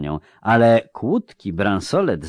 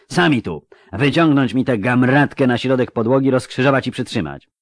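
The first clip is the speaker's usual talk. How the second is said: raised, commanding bellow; roared order; 5 semitones higher, 4 dB louder than usual. Acted. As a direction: bellow the order at the guards, louder and higher. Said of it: "zaryczał Bonhart". (rozkaz wykrzyczany podniesionym głosem)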